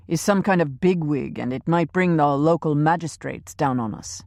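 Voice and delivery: plain reading voice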